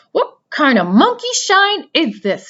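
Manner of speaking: high, loud grumpy rat voice; growling rat. Acted. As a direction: snarl it like a rudely woken rat. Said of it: "he growled".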